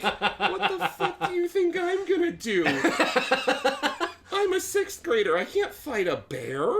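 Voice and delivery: high, squealed mock disbelief; incredulous protest. Sixth grader answering a clinging smaller kid, incredulous protest. Retelling own panicked retort to bully.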